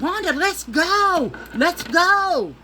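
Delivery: high, loud, slow pleading to leave; panicked urging. Retold or imitated. Stoned friend begging Wanda to go, panicked loud. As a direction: beg her to go now, voice climbing.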